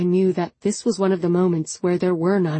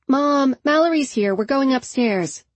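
higher call across the house; 6 semitones higher than usual. A narrator's reading. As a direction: call downstairs to mom. Calling to mom downstairs.

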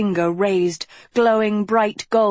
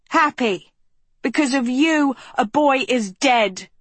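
raised, furious yell; 7 semitones higher than usual. A narrator's reading. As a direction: throw it back at him hard. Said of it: "Agatha yelled".

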